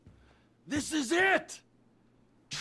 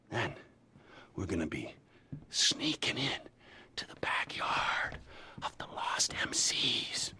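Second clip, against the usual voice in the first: conspiratorial whisper about sneaking in; secretive breathy plotting; 6 dB quieter than usual. Acted. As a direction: hush it like you're already creeping through their yard. Sneaking into rival gang's backyard.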